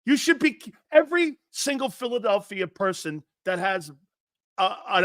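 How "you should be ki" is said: usual speaking voice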